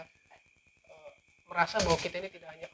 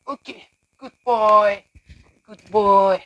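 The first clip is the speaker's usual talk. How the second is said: squeaky praising a dog; 3 semitones higher, 16 dB louder, much slower than usual. Real, not acted. Praising the dog after eating.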